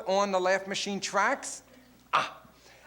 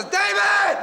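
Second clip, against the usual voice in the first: a much higher, loud shout of "David!"; mother's long-distance yell; 12 semitones higher, 7 dB louder than usual. Retold or imitated. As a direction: yell the name down a phone line. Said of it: "why is it when people call long distance, they yell".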